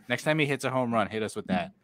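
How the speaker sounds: ordinary talk, nothing marked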